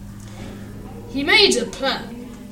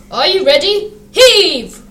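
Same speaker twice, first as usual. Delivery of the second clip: loud command building to a big heave; called command; 4 semitones higher, 7 dB louder, slower than usual. A narrator's reading. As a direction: call out to the team, loudest on the last word. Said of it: "called the Fat Controller".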